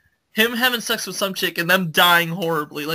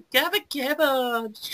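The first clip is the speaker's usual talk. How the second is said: higher, drawn-out mocking babble; mock preppy girl; 6 semitones higher, 4 dB quieter, slower than usual. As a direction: caricature a preppy girl's chatter. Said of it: "women that are all, like, all fucking preppy".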